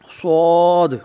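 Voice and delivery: صاد with a heavy, thick sound; heavy sad. Shown as a demonstration. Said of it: "ان کو موٹا پڑھنا ہے". (صاد کو موٹا اور بلند پڑھیں)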